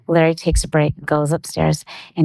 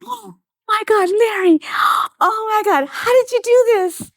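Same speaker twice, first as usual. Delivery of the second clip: high, gasping re-enacted amazement; so excited. Wife to husband on opening diamond earrings, so excited. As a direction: gasp and squeal as if opening the gift. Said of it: "so excited".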